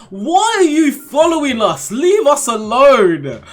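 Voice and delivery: mock exasperated scolding. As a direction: shoo off the pest, half joking.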